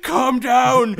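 high, loud order to settle; hammed-up yell to restore order; mock shout. Sane Pokemon to a crazed one, mock shout. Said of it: "Pokemon who are still sane".

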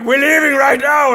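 loud, high mock shout; impatient shout. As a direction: hurry him out with a hammed-up yell. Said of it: "someone is like".

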